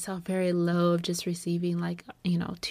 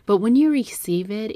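emphatic; 2 semitones higher, 10 dB louder than usual. Real, not acted. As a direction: drive it home. Emphatic testimony.

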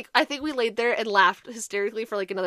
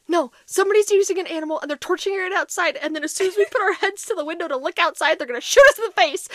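higher, louder, fast frightened rush of words; paranoid panic; 9 semitones higher, 7 dB louder, faster than usual. Retold or imitated. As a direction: spill out a paranoid theory in a rush. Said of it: "panicked, paranoid".

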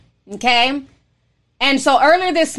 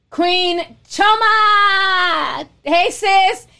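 drawn-out greeting call; 7 semitones higher, much slower than usual. Real, not acted. Calling out to a viewer.

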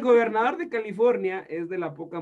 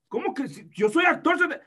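indignant actor; 7 semitones higher than usual. Acted. Plays Tom Cruise, indignant.

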